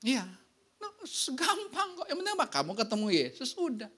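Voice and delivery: ordinary talk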